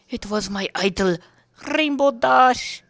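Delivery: squealing fan gushing over Rainbow Dash; over-excited gushing. Pretend. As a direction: gush about your hero like a fangirl. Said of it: "A little over-exaggerating".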